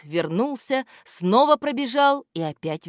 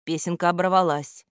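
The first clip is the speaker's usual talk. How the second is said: ordinary delivery, nothing marked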